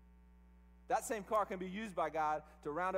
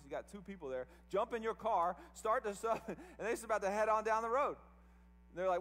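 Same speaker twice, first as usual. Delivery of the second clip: normal talking voice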